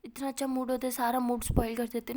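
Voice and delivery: loud, peeved comeback; annoyed retort. Acted. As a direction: snap back in a lovers' tiff. Acted quarrel, snapping back.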